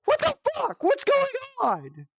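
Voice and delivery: shrill-free but very high baffled retelling; high confused outburst. Retold or imitated. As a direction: squeak out your confusion. Retold confusion at brother.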